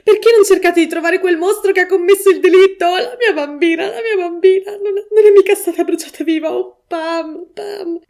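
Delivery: high sobbing shout fading to a whimper; mother crying for her dead daughter, loud then dying away; sobbing outcry. A narrator's reading. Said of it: "gridò la signora Reeves".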